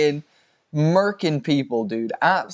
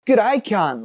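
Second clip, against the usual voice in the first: put-on higher-pitched jokey Australian accent; mock Australian accent; 7 semitones higher than usual. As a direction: greet like a cheerful Aussie, lightly hammed. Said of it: "They're like".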